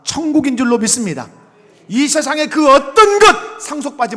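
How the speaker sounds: loud, high, impassioned sermon climax; fiery preaching. Acted. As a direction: preach it at full force to the hall.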